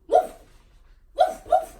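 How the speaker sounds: high loud playful dog bark. Retold or imitated. Teacher barking like a dog for the class to guess. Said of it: "what is it?".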